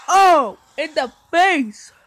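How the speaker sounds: loud, slow gloating yell; real loud taunt, drawn out; gleeful taunt. Real, not acted. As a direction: rub it in his face. Taunting the opponent.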